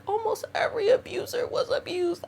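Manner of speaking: high, soft mocking singsong of what people say; parroting a tired excuse, high and soft; mocking quote. Mocking what people tell her, high soft parody voice. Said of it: "people say".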